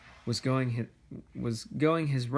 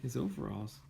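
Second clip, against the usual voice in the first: soft aside; 2 semitones lower, 11 dB quieter than usual. Real, not acted. Quiet aside to child.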